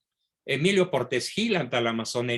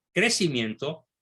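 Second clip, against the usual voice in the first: usual speaking voice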